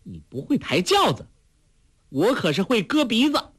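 high, menacing rebuke; commanding threat. A narrator's reading. Heroine threatens the fat lady with her blade, sharp rebuke. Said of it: "喝道".

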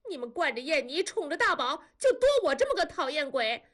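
high-pitched tearful outburst, rushed in bursts; wailing. A narrator's reading. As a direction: hurl accusations through acted sobs. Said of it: "多多嚎哭地说". (哭嚎着控诉)

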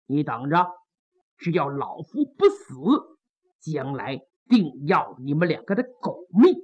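low, soft, seething threat; through gritted teeth. Acted. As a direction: grit your teeth and spit the threat quietly. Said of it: "潘仁美一咬牙".

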